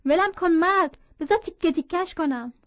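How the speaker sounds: acted yell, high and loud; narrated cry, struggling to be let go; mother's furious shout. A narrator's reading. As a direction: shout back as an enraged mother. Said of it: "مادرم در جوابش فریاد زد".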